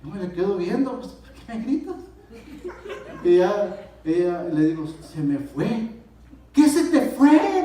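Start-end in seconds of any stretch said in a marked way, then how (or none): 1.1-2.0 higher, softer puzzled voice; acted bewildered question. Bewildered reply to screaming wife. 6.5-7.6 high, loud, drawn-out question; wife's startled yell, reenacted; imitating alarmed wife. Wife shouts back in alarm.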